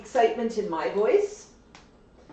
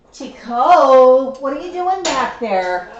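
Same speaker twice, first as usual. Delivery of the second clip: much louder call across the room; 3 semitones higher, 12 dB louder, slower than usual. Real, not acted. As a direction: call the bird out for sneaking. Owner calls out to the bird.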